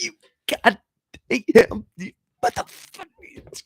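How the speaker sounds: very high, slow, choking on the words; a mother too angry to finish the curse; strangled with rage, the words barely come out; sputtering frustrated mother. Retold or imitated. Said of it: "she could never, like, get the words out".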